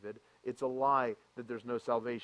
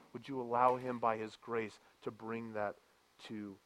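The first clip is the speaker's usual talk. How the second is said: ordinary talk, nothing marked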